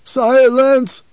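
very loud, high-pitched roar; roared command. A narrator's reading. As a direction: roar to cut the guide off. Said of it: "the preacher roared".

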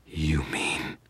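hushed breathless whisper; stunned whisper. Acted. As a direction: breathe it out in dread. Victor realizing demon is free.

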